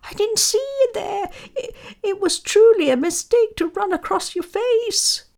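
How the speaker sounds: very high, hurried excuse in a mouse voice; squeaky mouse. A narrator's reading. Mouse explains itself to the angry lion, fast high squeak. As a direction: squeak out a nervous, stammered apology. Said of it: "he squeaked".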